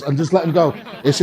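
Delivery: normal talking voice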